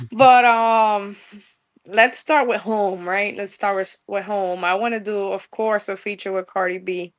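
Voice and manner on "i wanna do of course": usual speaking voice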